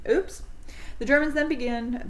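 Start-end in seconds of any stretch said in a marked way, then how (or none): 0.1-0.4 mock innocent oops. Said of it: "and Hitler said".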